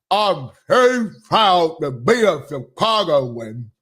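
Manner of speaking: lightly mimicked proud Chicagoan, low and slow; mock interviewee. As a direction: draw it out slowly in a mocking echo of her.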